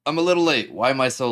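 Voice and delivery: high, loud burst of energy; excited. Shown as a demonstration.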